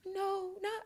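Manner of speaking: high, tiny, whimpering reply; sheepish small voice. Retold or imitated. Admitting to her teasing girlfriend, in a sheepish high voice. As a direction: shrink it into a high little voice.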